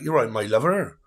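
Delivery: jokey West Country yokel greeting a lover; playful West Country accent, lightly hammed up. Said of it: "that kind of accent where it's like".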